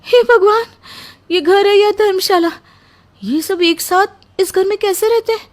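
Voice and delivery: high, loud gasp of disbelief; shocked exclamation. Acted. New bride aghast at the huge in-laws family, shocked. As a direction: cry out in shock at the crowd.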